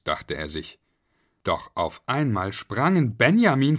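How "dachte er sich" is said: ordinary delivery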